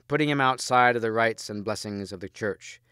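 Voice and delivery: ordinary delivery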